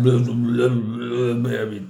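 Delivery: low, dismissive babble, imitating; mocking blah blah. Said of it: "Wo ihr beide ja auch gesagt habt".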